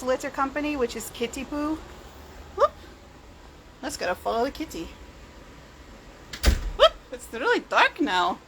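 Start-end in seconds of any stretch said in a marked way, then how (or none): 2.6-2.7 short high whoop. Real, not acted. Startled while following the cat. 6.8-6.9 quick high-pitched whoop; a sudden little yelp of surprise. Real, not acted. Startled while following the cat.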